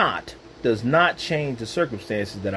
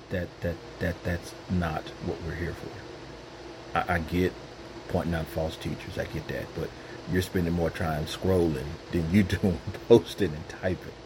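low, soft, gentle voice of God; voicing God; 10 semitones lower, 9 dB quieter than usual. God gently nudging the believer to pray, low and soft. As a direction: speak as God, gently and much lower. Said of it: "when the Lord is talking like that".

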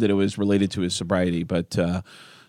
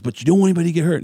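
a quick, lightly higher mock of Randy's tag line; 7 semitones higher, much faster than usual. Randy adds the line on camera, fast and lightly raised. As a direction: toss it off fast. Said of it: "he goes".

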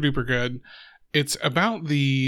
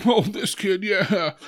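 impressed onlookers; 6 semitones higher, slower than usual. Retold or imitated. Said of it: "they're like".

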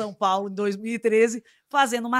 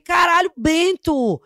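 loud, higher, fast reproach; shouting her hurt at Bento, mid-argument; retold angry yell; 6 semitones higher, 6 dB louder than usual.

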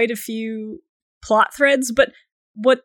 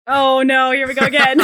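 laughing groan; 3 semitones higher, 5 dB louder than usual. Real, not acted. Sylvanas topic again.

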